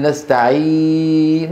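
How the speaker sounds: نستعين with a long level ee; same sound as madd. Shown as a demonstration. Teacher asks whether it matches the leen sound, sustained madd. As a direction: hold the ee of نستعين steadily. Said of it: "نفسه".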